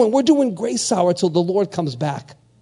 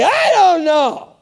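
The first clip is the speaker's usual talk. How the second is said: high, loud, drawn-out I don't know; emphatic exclamation; 11 semitones higher, 7 dB louder, slower than usual. Acted. Preacher answering his own question, emphatic exclamation. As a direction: throw it out high and slow.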